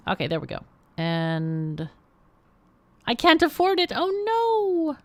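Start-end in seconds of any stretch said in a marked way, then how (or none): 3.1-5.0 dismayed outcry. Real, not acted. Player finds she can't afford the bottler, dismayed outcry. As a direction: cry out in dismay, stretching the "no". Dismay at being short of money.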